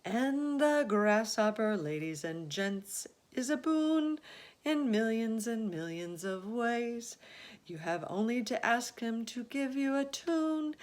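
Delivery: high, lilting verse; rhyming recital. Acted. James introduces the centipede in verse, high and lilting. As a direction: present each friend proudly in rhyme.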